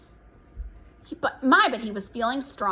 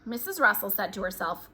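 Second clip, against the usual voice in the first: plain reading voice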